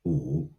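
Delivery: "u" held low and soft; third tone. Shown as a demonstration. Teacher modelling tones for repetition, "u" low. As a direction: drop "u" low in the voice. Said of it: "it is a low".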